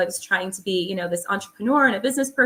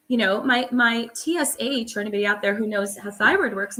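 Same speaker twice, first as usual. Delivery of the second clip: usual speaking voice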